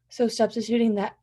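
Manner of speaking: ordinary delivery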